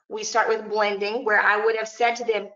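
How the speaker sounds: normal talking voice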